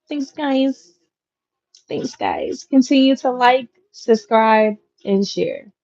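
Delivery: a hint of a polite good-girl pitch. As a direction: play the sweet good girl thanking viewers. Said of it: "that's my good girl voice".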